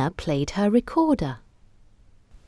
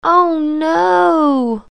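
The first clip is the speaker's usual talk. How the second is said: put-on dismayed child voice; 11 semitones higher, 4 dB louder than usual. Kipper dismayed.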